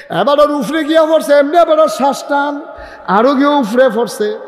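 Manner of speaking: full-force preaching. Real, not acted. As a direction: ring it out to the crowd, pitched high.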